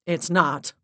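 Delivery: loud, flat retort; curt snap. A narrator's reading. Max cuts off Larissa, curt and loud. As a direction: snap it back. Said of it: "Max snapped".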